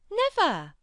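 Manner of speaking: high, loud Yorkshire disbelief; astonished. A narrator's reading. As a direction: cry out in disbelief. Martha astonished.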